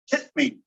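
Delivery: casual HIT ME, glottal stop for T. Shown as a demonstration. Teacher demonstrating an allophone of T, "hit me" with glottal stop. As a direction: HIT ME with the T of HIT shut in the throat. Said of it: "glottal stop where it slaps shut".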